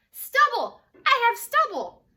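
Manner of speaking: high, loud delighted exclaim; thrilled shout of discovery; excited discovery. Acted. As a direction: cry out in joyful surprise. Character discovers stubble, excited.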